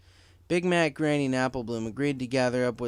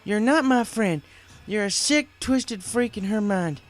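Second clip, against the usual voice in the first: higher, louder furious rebuttal, slowing at the end; defiant anger; 10 semitones higher, 6 dB louder, slower than usual. Acted. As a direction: spit back the insult. Applejack defies the killer.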